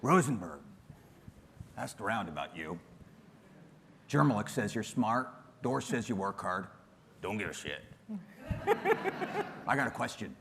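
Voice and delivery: a hint of a blunt, gruff coach; lightly voiced gruff mentor. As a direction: play the tough coach who doesn't care. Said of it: "This guy comes in".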